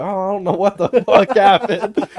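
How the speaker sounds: high, loud mock cluelessness; badly faked innocent protest; feigned innocence. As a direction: plead ignorance through a laugh. Acts innocent about dead internet.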